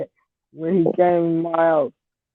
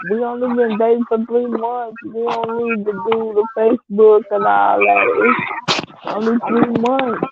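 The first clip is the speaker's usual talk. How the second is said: imitating boyfriend; 5 semitones higher than usual. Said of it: "And he's like".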